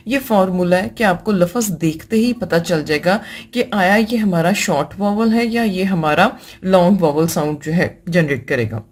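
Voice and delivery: ordinary talk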